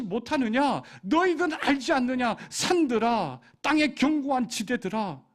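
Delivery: fervent preacher voicing God's lament; preaching at full force. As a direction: drive it home like a pleading preacher.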